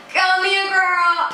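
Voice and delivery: high, drawn-out imitation of a guy beckoning; imitated beckoning guy. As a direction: mimic him calling her over, stretched on one high note. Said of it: "he pulls his arms out and he's just like".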